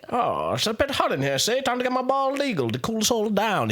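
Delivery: lightly hammed old-time mobster; 1930s gangster. Villain bringing out his eagle, playful gangster voice. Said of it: "I'm also from the nineteen thirties".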